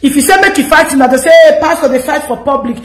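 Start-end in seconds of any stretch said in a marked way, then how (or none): none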